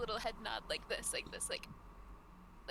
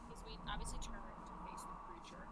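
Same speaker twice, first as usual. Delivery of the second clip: whisper; 7 dB quieter than usual. Acted. Player whispers in character.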